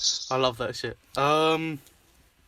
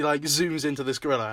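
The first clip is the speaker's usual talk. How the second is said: ordinary talk, nothing marked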